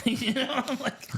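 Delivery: words bubbling with laughter; laughing. Real, not acted. Laughing while telling friends. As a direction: laugh through it.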